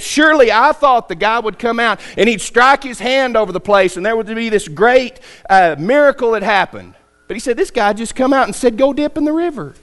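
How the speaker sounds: mocking disbelief at the simple order, pitch up; angry griping. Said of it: "He was angry".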